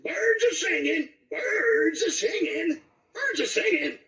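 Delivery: put-on high, slow woozy old-timer repeating himself; Dynamite voice. Shown as a demonstration. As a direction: warble it like he's just been blown up. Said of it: "you give 'em".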